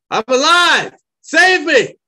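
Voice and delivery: high, loud cry for rescue; hoarse shout. Acted. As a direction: yell out from under the rubble. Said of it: "a hoarse shout".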